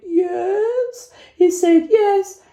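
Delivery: very high, strained squeal; mock hysterical. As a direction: retell it in a squeaky, hammed-up wail. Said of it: "I was hysterical".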